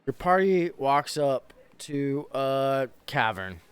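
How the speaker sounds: slow, measured scene setting; game master narration. Acted. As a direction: set the dungeon scene slowly.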